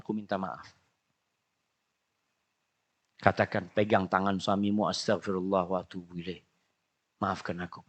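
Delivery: low, soft humble plea; soft apology. Acted. Wife apologizing to her angry husband, soft and low. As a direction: beg forgiveness softly.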